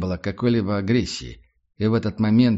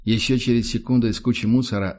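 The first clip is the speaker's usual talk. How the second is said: ordinary delivery, nothing marked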